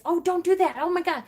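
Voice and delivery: high, pleading parent reaction, lightly acted; alarmed parent. Parent hearing a child self-harms, mildly alarmed. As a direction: play the parent's first shocked reaction. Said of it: "that's our first reaction is".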